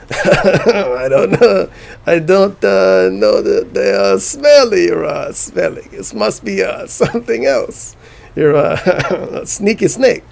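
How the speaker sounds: put-on high, halting French accent, laughing between phrases; hammed-up French accent with drawn-out hesitations; cartoon French accent. Streamer mocking the Vidar accent, cartoon Frenchman. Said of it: "It's like a generic cartoon Frenchman".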